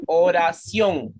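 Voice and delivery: "oración" slowly as o-ra-ción; slow "oración" with ción as one syllable. Shown as a demonstration. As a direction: stretch "oración" into three beats. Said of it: "cualquier palabra que termine en ción".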